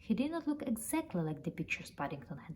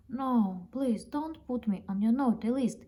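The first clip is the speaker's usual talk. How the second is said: higher, louder pleading cry from a nightmare; crying out in sleep; 5 semitones higher, 6 dB louder than usual. Acted. Bear crying out in his sleep, pleading not to be on the naughty list. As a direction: beg out loud, half asleep. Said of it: "he cried out of his sleep".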